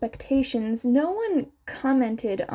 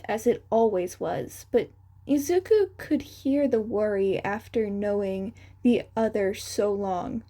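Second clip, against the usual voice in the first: usual speaking voice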